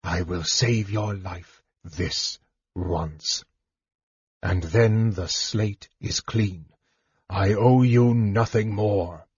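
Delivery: slow, icy, measured words; cold, hushed deliberateness; chill voice. A narrator's reading. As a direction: lay down terms coldly and slowly. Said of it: "his voice was as chill as the dark waters".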